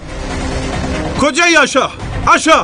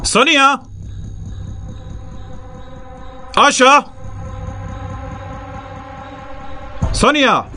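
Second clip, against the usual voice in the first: calling out. Acted. Calling for lost girls.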